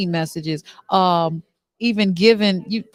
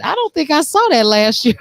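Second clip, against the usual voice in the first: ordinary talk, nothing marked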